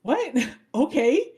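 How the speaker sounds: high startled what, then a laughing okay; baffled. Retold or imitated. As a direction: caught off guard, then shrugging it off. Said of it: "he's like".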